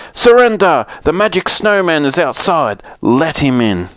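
high, loud bear shout of command; loud demand. Acted. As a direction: bark the order at the children. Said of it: "they cried".

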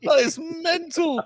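high, slow, amazed; excited. Real, not acted. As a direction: stretch it out in awe. Admiring the figure.

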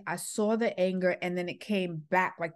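usual speaking voice

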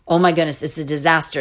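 usual speaking voice

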